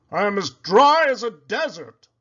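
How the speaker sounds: a pig fretting over his dry skin; worried Poppleton. A narrator's reading. Pig calling his friend, high and dismayed. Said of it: "said Poppleton".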